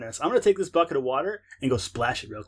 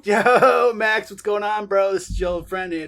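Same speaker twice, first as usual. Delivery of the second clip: loud and high, bro greeting; excited; 7 semitones higher, 8 dB louder than usual. Acted. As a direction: hype buddy calling a friend. Character calls Max, excited.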